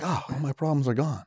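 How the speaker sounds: mock relief. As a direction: let it all go quietly. Acting out relief after rage quit.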